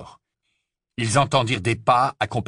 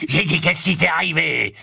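impatient repeat; 6 semitones higher than usual. A narrator's reading. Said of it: "répéta Greyback".

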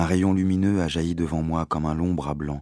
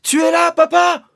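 high loud call; boy calling out for his father, shouting; 8 dB louder than usual. Acted. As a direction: yell for him into the trees. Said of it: "j'ai crié".

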